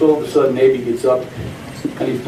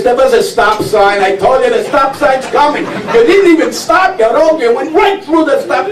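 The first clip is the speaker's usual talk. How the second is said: nagging wife; 10 semitones higher than usual. Acted. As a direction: pile it on like a wife who warned him. Wife scolding husband in car.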